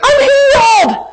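very high, stretched-out wail; hammed-up tearful cries of relief; imitated joyful crying. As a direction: wail it slowly like an overwhelmed girl. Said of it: "And she's crying and crying".

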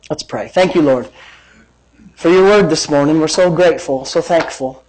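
low, hushed thanks to God; prayer. Real, not acted. Leads congregation in prayer.

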